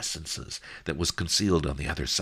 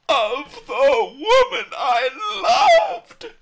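much higher, far louder, slow mock shriek; hammed-up shriek; 12 dB louder, much slower than usual. Boy fakes despair before the merchant, slow loud shriek. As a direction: scream the grief out theatrically. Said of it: "I shrieked".

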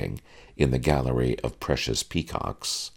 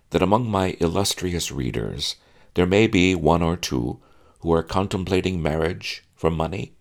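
usual speaking voice

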